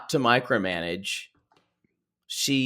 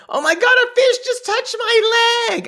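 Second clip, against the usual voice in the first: put-on very high, loud girlish squeal; mock squealing cousin; 5 dB louder than usual. Cousin startled by a fish, squealed very high. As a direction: shriek like a fish touched you. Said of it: "my cousin one time said".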